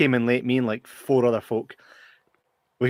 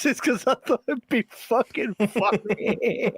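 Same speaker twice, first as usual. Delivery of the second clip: high, slow words squeezed through laughing; giggling high-pitched retold excuse; helpless laughter; 10 semitones higher, slower than usual. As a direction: confess it while cracking up. Retelling confession while helpless with laughter.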